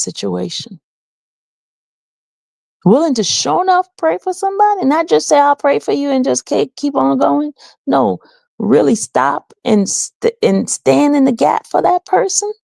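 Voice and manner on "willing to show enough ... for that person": fervent. Real, not acted. Passionate about praying for others.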